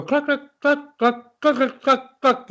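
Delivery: high squawky clucks like a hen; chicken clucking. Retold or imitated. Teacher imitating chickens for the piece, high clucking. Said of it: "when you listen to chickens cluck".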